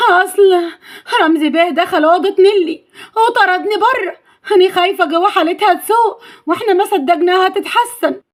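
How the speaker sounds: high, distressed maid's voice; acted anxious tearful tale-telling; worried, upset. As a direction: report the danger in a pleading high pitch. Maid reports danger in alarm.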